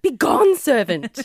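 grand mock-royal command; haughty dismissal. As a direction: dismiss the servant like a queen. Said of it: "And I was like".

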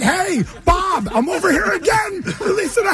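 high, sing-out mock call; taunting call-out to the no-show. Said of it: "taunting the Middletown".

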